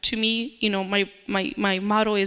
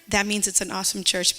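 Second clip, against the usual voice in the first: ordinary delivery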